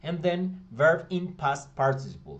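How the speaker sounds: reading the formula out slow and loud; slow, emphatic formula. Shown as a demonstration. Teacher to class reading the formula, slow and loud. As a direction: spell out each piece of the rule. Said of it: "¿Sí ven?".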